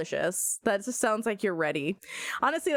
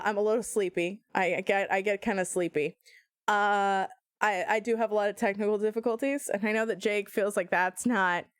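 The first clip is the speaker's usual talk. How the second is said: ordinary talk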